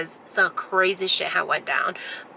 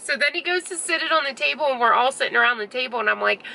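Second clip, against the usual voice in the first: ordinary talk, nothing marked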